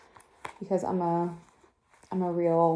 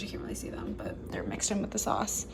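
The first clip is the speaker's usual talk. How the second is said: whisper; 11 semitones lower, 11 dB quieter than usual. Real, not acted. Aside while filming.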